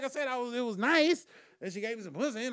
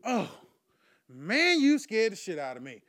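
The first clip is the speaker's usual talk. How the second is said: relieved exclamation; 8 dB louder than usual. Retold or imitated.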